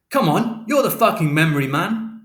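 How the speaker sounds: loud, high sarcastic yell; angry taunt. A narrator's reading. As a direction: jeer at him furiously. Father taunting son angrily.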